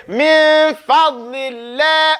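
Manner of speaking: slow Quranic min fadlillah, min stressed; drawn-out recitation. Shown as a demonstration. Teacher showing students the light lam. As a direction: model min fadlillah slowly with the tongue pushed to the sides. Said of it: "so you say".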